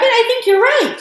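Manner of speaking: high, loud and taken aback; startled realisation. A narrator's reading. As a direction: exclaim it as it dawns on you. Mister Bucket startled by the date.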